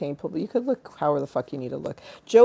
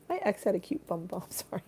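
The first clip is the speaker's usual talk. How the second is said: ordinary delivery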